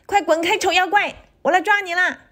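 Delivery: put-on high-pitched childlike battle cry; high, spirited threat; child's shout. A narrator's reading. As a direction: call out boldly like a small child. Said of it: "我也喊道".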